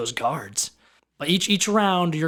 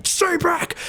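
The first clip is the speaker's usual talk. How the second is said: shouted order. Acted. GM voices guard in chaos.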